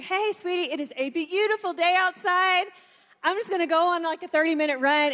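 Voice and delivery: husband greeting his frazzled wife before a run, chipper and high. Retold or imitated. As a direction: play the sunny husband off for a run. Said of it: "so chipper".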